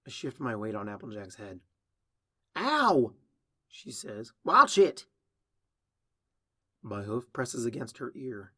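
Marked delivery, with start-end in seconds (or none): 2.6-3.1 high, loud cry of pain; pained yelp. Acted. Applejack with the filly shifting on her head, pained yelp. 4.4-5.1 loud, high, pained reprimand; sharp warning. Acted. Applejack hurt by a hoof on her ear, sharp warning.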